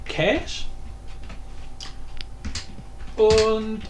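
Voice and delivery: ordinary talk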